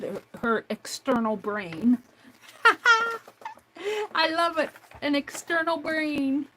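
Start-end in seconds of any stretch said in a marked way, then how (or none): 2.6-3.5 high bright burst of laughter; delighted laugh. Real, not acted. Laughing at external brain. 4.1-6.5 delighted. Real, not acted. Delighted by friend's phrase.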